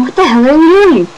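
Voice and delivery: high, loud retold scream. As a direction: yell it at the groper across the ferry. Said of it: "'cause I was screaming".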